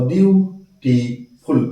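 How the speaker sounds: "Beautiful" very slowly in three separate parts; split into syllables. Shown as a demonstration. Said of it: "তিনটি syllable আছে".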